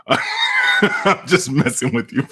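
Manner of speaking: cracking up mid-sentence; breaking into laughter while joking; laughing. Real, not acted. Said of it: "I tried to keep a straight face".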